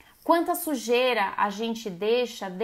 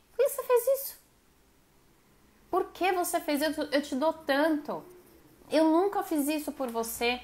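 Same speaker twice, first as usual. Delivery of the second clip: demanding partner; 4 semitones higher than usual. Retold or imitated. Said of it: "é aquele amor que cobra".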